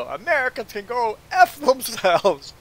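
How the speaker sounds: high, loud, drawn-out comeback; acted-out outraged dismissal; retold angry retort. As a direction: fling it back loudly and slowly. Retelling heated retort.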